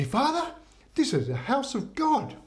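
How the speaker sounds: high-pitched mock reproach; scandalised bishop. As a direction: act appalled, pitch jumping up. Bishop scolds the priest.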